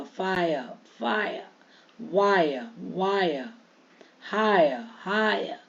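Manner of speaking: "फायर", "वायर", "हायर" ending in -ya, no r; slow word drill, each falling on an open a; silent r. Shown as a demonstration. Teacher drilling dropped r, word by word. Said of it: "नहीं होगा".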